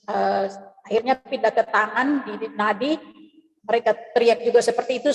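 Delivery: ordinary talk, nothing marked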